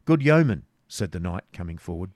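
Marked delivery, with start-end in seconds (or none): 0.1-0.7 loud, high call to intervene; hailing. Acted. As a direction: break in with a raised voice. Knight intervenes, hailing the yeoman.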